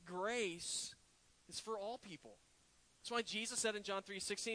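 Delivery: normal talking voice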